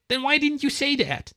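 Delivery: put-on high, impatient kid whine; squeaky annoyed boy; whiny squirrel.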